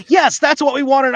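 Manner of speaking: high, loud mock insistence; acted-out loud confirmation; emphatic reply.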